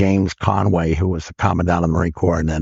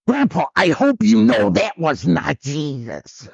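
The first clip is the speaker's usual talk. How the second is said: put-on slow, high little-kid voice; imitated small boy, earnest and drawn out; little grandson; 6 semitones higher than usual. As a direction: correct Grandpa slowly, pitched up like a child. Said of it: "little Russian grandson goes".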